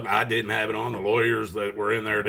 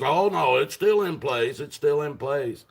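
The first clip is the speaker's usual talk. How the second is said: higher, drawn-out insistence, imitating him; mock reassurance; 5 semitones higher than usual. Prosecutor reassuring a doubting defendant, mock insistent. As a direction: imitate someone insisting, pitched up.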